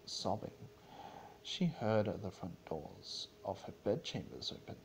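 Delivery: plain reading voice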